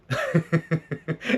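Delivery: breaks into a high helpless laugh; burst of laughter. Real, not acted. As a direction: laugh at himself, losing composure. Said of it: "so I can regain my composure".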